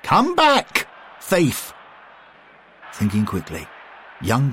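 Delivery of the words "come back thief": put-on high, loud old man's call; calling out as Bill. A narrator's reading. Bill calls after the thief, high and loud. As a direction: shout after the fleeing thief. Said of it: "Bill called out".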